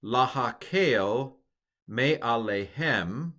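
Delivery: Lahakel me'alehem read slowly, syllable by syllable; slow careful Hebrew reading; slow Hebrew demo. Shown as a demonstration. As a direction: sound out Lahakel me'alehem for learners. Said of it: "Can you pronounce this line?".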